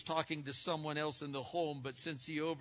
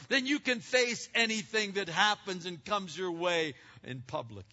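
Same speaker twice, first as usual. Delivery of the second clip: louder and higher, pressing the point; preaching at full force; 7 semitones higher, 7 dB louder than usual. Acted. Pastor urging the congregation, full-force preaching. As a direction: preach it to the back row.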